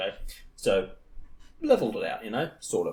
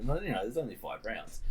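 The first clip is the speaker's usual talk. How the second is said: plain reading voice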